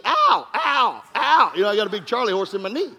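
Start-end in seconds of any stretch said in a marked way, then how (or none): 0.0-1.5 light mock yelps of pain. Pastor retelling knee bump, mild mock pain cries. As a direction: hop and yelp. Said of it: "hopping around holding my knee".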